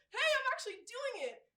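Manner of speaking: high, loud, laughing excitement; giddy acted triumph; delighted surprise. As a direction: burst out amazed at your own success. Acted delight at managing to dribble.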